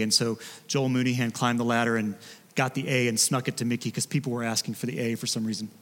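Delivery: ordinary talk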